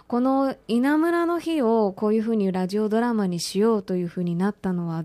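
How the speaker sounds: ordinary delivery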